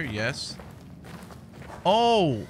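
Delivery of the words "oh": alarmed oh no. Real, not acted. Sees something scary in game.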